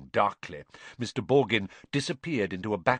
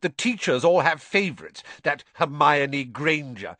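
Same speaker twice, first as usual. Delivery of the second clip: high, loud petulant complaint; sulky boy, pitched up; whiny spoilt boy; 7 semitones higher, 6 dB louder than usual. Acted. Draco complains to his father.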